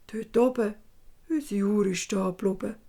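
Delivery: lightly voiced dwarf pointing up; dwarf showing the father the stopped clock. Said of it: "sagt dann plötzlich der Zwerg".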